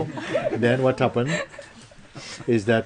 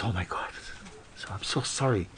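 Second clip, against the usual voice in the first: softer, sheepish sorry; quiet embarrassed apology; 10 dB quieter than usual. Acted. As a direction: apologise under your breath, embarrassed.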